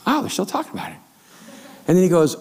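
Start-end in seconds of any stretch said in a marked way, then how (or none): 0.1-1.0 lightly voiced staff. Imitating office chatter.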